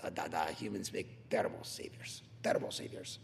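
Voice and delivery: put-on low, hushed Russian-accented voice; Russian accent sinking almost to a whisper; Soviet-style Russian accent. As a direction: do a Soviet Russian, deep and under your breath. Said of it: "like that Soviet Union style".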